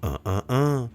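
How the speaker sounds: high, warning uh uh uh from inside; a hint of a nagging inner voice. Voicing the inner voice that warns him, in a high warning tone. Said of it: "that voice within that tell us".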